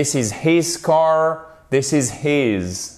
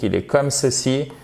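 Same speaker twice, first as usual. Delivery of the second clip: normal talking voice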